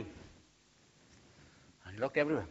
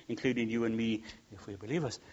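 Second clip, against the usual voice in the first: normal talking voice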